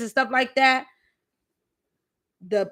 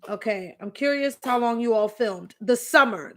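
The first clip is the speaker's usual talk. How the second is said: ordinary delivery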